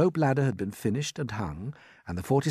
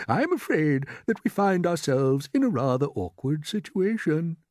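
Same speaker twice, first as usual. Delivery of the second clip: measured, higher, steadied voice; fear held back; 8 semitones higher, 4 dB louder than usual. A narrator's reading. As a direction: keep the worry out of it. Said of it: "keep the fear ... of his voice".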